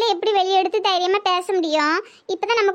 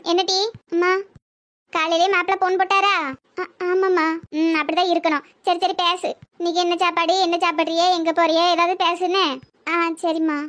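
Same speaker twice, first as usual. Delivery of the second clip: normal talking voice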